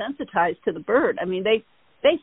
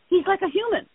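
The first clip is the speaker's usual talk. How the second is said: lightly voiced amazement, pitched higher; retold amazed stranger; 9 semitones higher than usual. Stranger to bird's owner at red light, mildly amazed. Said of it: "he looks at me and he goes".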